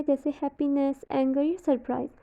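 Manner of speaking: normal talking voice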